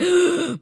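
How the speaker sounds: sharp, high, loud fake gasp; mock gasp. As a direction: gasp in exaggerated shock. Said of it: "I was like".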